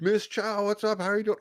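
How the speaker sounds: cheery greeting. Retold or imitated. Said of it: "I go in there and I'm like".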